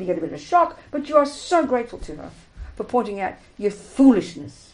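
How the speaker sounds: ordinary talk, nothing marked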